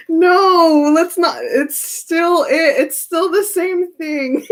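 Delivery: high, slow, giggly protest; laughing through it. Real, not acted. Reader protesting at the repeated card, laughing.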